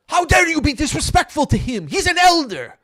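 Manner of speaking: high, loud outraged yell at a stranger; furious shout. Retold or imitated. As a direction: bark it out, scandalized on grandpa's behalf. Retold angry son yelling.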